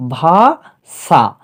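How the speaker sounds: ভাষা very slow, split as ভা, ষা; syllable by syllable. Shown as a demonstration. Teacher showing how Bangla is pronounced in steps, syllable by syllable. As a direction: stretch ভা, pause, then ষা in ভাষা. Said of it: "এই রকমভাবে বাংলাতেও উচ্চারণ করা হয়".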